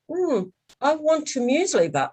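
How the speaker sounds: put-on child's demand losing its certainty; perplexed, wavering demand. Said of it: "you could tell the tone was, was changing".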